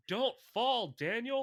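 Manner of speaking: loud, high-pitched shout of alarm; yelling at the TV. Retold or imitated. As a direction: yell it like a fan pleading with the screen. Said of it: "I literally yelled at the T V".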